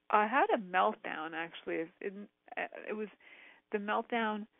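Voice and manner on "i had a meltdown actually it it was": ordinary talk, nothing marked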